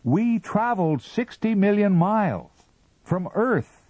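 high and loud call. A narrator's reading. Captain calls up to Mister Ah Ah, high and loud. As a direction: call it up proudly. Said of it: "he called".

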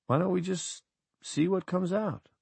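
hushed and gentle, slowing at the end; very quiet. Acted. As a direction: suggest it very quietly. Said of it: "very quietly indeed".

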